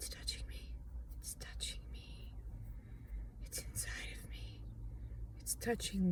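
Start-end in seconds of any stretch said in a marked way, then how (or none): 0.1-0.7 breathless panicked whisper; hushed, creeped-out whisper; frightened whisper. Real, not acted. Panicked over something inside her. 1.2-2.3 whispered in fright, no voice; scared whisper of It's touching me. Real, not acted. Panicked whisper then voiced. 3.4-4.6 breathless panicked whisper; hushed, creeped-out whisper; frightened whisper. Real, not acted. Panicked over something inside her.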